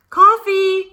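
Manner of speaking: ordinary delivery, nothing marked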